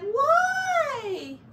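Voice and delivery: high squeaky little why; high mouse question. Pretend. The mouse asks why in a high squeak. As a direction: ask it like a puzzled tiny mouse.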